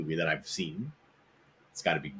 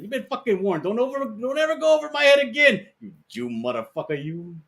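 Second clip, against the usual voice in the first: much higher, hammed-up mobster threat; mock gangster threat; 12 semitones higher than usual. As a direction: squeal the threat like Pesci. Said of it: "He's like".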